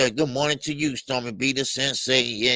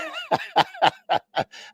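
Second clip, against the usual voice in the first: high burst of laughter; hearty laugh. Real, not acted. Host laughing at being told he sounds like a robot, hearty laugh. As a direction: crack up at your own story.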